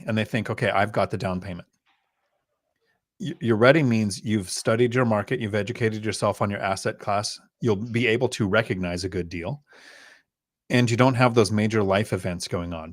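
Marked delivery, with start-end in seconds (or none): none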